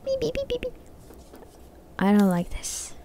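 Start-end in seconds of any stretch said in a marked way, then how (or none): 0.1-0.7 imitated beeping. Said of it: "Can you hear that?".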